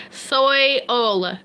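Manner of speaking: "soil" drawn out slowly as SOY-ull; overcareful, slow "soil" with a full oy; careful pronunciation. Shown as a demonstration. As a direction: stretch "soil" into two parts, soy-ol. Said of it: "Did I say it right that time?".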